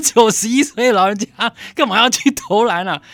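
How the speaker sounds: playful mock scolding outcry; incredulous exclamation. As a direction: exclaim at a ninety-one-year-old shooting hoops. Incredulous quote to old man.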